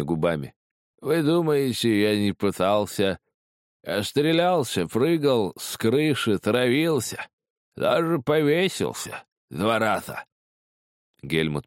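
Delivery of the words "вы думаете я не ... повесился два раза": high, slow, quavering drawl; rattling, lisping voice. A narrator's reading. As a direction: list them slowly in a lisping quaver. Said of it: "дребезжащий, слегка шепелявый голос". (высокий дребезжащий голос, шепелявя)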